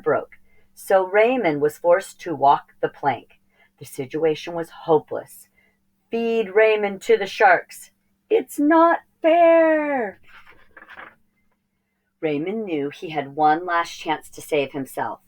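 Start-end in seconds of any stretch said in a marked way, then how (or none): 6.1-10.2 whiny complaint. Acted.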